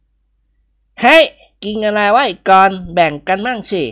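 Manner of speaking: character voice. A narrator's reading. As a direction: voice the hungry friend begging a share.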